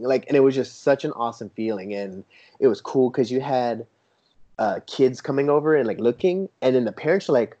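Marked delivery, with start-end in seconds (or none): none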